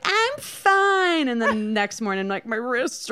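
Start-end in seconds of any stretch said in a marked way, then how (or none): 0.0-1.3 mock drunk insistence. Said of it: "I was like".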